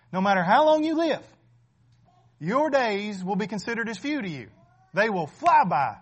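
loud, driving sermon voice, pressing each point home; preaching at full force. Acted. Pastor warning the congregation their days are few, preaching loud. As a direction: preach it hard to the pews.

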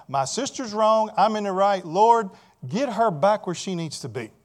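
indignant Martha. Retold or imitated. Martha demanding the Lord fix her sister, loudly. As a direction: insist loudly you're in the right. Martha's prayer voiced by preacher.

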